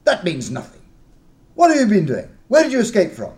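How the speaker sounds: loud, high furious accusation; angry disbelief. Acted. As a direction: tear into their story. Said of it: "dismissed with anger".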